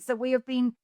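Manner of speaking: usual speaking voice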